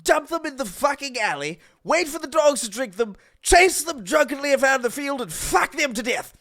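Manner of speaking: mild hammed-up old Brit reminiscing; gently put-on old man voice; lightly voiced old man. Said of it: "it's just old man Stewie".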